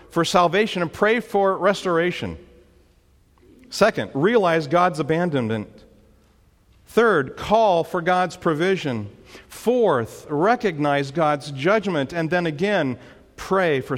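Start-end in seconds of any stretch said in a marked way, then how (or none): none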